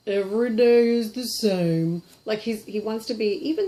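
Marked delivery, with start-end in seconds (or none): 0.1-2.0 slow, slack-jawed dopey voice. Pretend. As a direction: drag it out like a dopey puppet. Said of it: "a little bit of a dopey voice".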